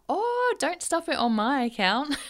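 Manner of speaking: imitated teasing daughter. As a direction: play the unfazed kid teasing her parents. Voicing daughter teasing embarrassed parents.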